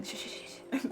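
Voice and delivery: soft unvoiced shushing; hushing. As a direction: hush them like a sleeper. Hushing for sleeping model.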